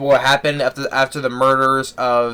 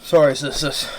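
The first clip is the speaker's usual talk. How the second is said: yawning. Real, not acted. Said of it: "I don't know why I'm yawning".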